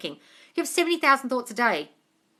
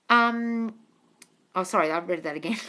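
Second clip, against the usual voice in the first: usual speaking voice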